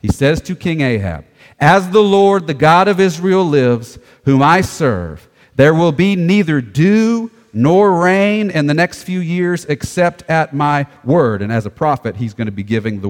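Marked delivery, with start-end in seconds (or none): 1.6-11.4 slow, raised, forceful scripture reading; prophetic proclamation. Acted. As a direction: declare it as a prophet's solemn oath.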